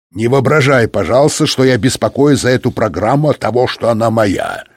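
usual speaking voice

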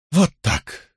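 pleased little declaration; satisfied. A narrator's reading. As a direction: say it with a touch of self-satisfaction. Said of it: "удовлетворённо заявила она". (удовлетворённо)

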